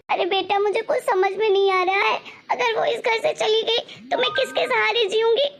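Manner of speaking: crying through the words, voice breaking; tearful. Acted. Mother tells daughter the bahu may leave, crying. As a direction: weep out her fear of being left alone.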